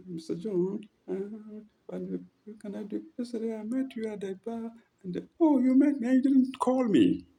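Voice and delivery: high, breathy-sounding put-on girl's voice, soft at first; mock girlish voice. Flustered young woman calling to Mister John, high mock voice. Acting a shy caller's voice.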